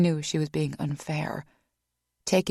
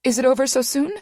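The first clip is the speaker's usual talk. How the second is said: higher, louder anxious ask; 8 semitones higher, 7 dB louder, faster than usual. A narrator's reading. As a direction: push worried. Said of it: "she asked anxiously".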